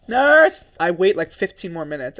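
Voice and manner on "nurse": loud mock call for the nurse. Friend calling the nurse as a joke, loud and high. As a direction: call the nurse across the ward, higher and louder.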